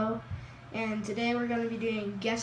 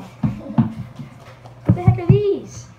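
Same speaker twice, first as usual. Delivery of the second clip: surprised; 8 semitones higher, 8 dB louder, much slower than usual. Real, not acted. Stunned by surprise shoes.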